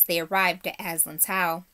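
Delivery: usual speaking voice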